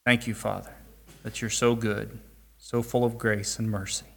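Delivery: soft, low closing prayer. Real, not acted. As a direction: pray quietly and humbly.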